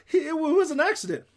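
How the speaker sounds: high, loud stammered protest; hollering. A narrator's reading. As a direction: holler it out in self-defence. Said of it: "I hollered".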